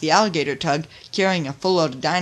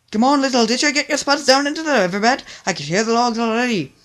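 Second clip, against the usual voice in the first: urgent; 7 semitones higher, 6 dB louder than usual. Acted. Urging crew as logs approach.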